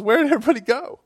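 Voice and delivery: preacher voices Jesus asking the woman where everyone went; mock surprise. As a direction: look around in feigned surprise.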